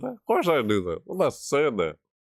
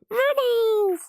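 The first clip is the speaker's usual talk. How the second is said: gleeful squeal. Real, not acted. Gleeful about minis.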